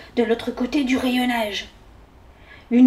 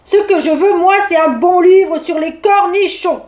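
loud, higher grumbling demand; raised voice; 6 semitones higher, 6 dB louder than usual. A narrator's reading. Bear demanding a good pickle book, loud and insistent. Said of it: "en élevant la voix".

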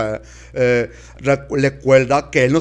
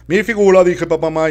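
indignant; 6 semitones higher, 6 dB louder, faster than usual. Acted. Indignant repeat.

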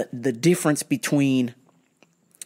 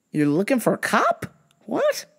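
baffled; 5 semitones higher than usual. Retold or imitated. Baffled reply to customer.

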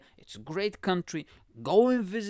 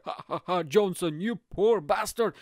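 mocking laughter; 4 dB louder than usual. Rich guests jeering at the poorer billionaire, mocking. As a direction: jeer at him, louder as it goes.

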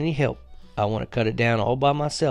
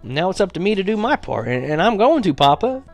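higher, pushing harder toward a vow; earnest, rising plea; 8 semitones higher than usual. Acted. Boy explaining his bargain to Papa with rising earnest resolve. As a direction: insist with growing determination.

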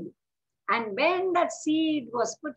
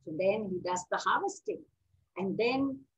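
usual speaking voice

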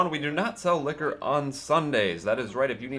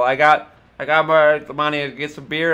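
put-on louder, higher drunk insisting; loud drunk woman; 4 semitones higher, 9 dB louder than usual. Drunk woman outside the locked door waving her twenty, loud and higher. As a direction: shout through the glass that you've got the cash.

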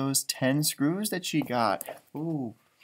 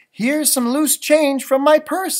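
higher, slower, a hint of kind mom; lightly voiced mom; 9 semitones higher, 12 dB louder, slower than usual. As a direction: give the coins like a helpful mom. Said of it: "said Mom".

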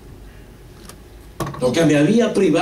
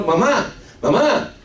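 high, urgent call through an empty house; desperate calling; 7 semitones higher, slower than usual. Retold or imitated. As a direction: call out for her, rising each time. Desperate son calling for mother.

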